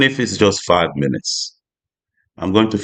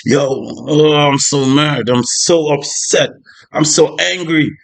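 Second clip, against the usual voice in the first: mock upset rant; 7 semitones higher, slower than usual. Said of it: "and you're like".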